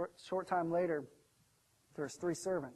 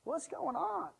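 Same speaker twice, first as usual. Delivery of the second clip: halting, puzzled question rising high; perplexed; 7 semitones higher, 6 dB quieter, slower than usual. Acted. Young son questions the angry digger, perplexed. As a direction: stammer it softly, baffled. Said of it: "The son's perplexed".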